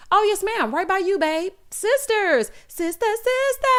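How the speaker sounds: high-pitched, sing-out mimicry; mock gushing. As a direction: gush in a high voice, stretching the last words. Mimics a gushing reply about seating.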